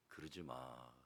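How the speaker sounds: hushed, gentle don't; soft plea. Real, not acted. 목사가 회중에게 조용히 타이르듯이. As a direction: plead softly to the congregation. Pastor repeats gentle counsel.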